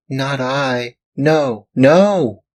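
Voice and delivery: high, rising tearful denial; sobbing bitterly. Acted. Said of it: "cried Pinocchio, sobbing bitterly".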